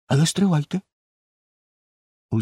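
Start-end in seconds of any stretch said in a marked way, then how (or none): none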